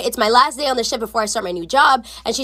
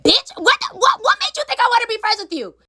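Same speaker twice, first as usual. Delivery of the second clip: high-pitched, stumbling mock outrage; hammed-up incredulous retort; indignant comeback; 7 semitones higher than usual. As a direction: sputter back, offended.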